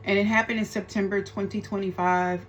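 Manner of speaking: usual speaking voice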